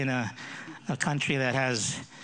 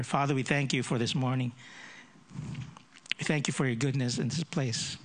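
earnest prayer voice, a little raised. Acted. Pastor leading the congregation in prayer, earnest. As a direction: pray aloud before the church.

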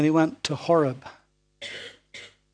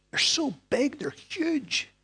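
awed spies; 10 semitones higher, slower than usual. Acted. Spies describing the giants.

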